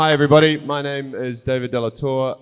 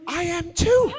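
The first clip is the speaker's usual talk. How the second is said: much higher, thrilled reply; excited squeal. Retold or imitated. [name] imitating Claire's excitement, high-pitched. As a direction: squeak it with delight. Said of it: "she's like".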